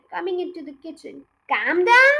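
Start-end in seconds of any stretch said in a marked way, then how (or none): 1.5-2.1 louder, outraged echo of her words; indignant cry. A narrator's reading. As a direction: throw her words back, appalled. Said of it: "cried Mister Cranky".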